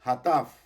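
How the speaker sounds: hataf called out loudly for repetition; say-with-me model. Shown as a demonstration. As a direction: model "hataf" loud and distinct for the class. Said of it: "Diga conmigo".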